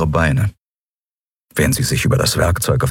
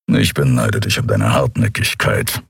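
big furry mechanic teasing his captain; rumbling Keru; 7 semitones lower than usual. A narrator's reading. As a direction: lower creature rumble. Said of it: "brummte Keru".

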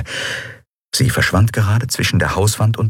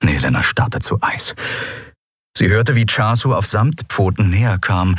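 usual speaking voice

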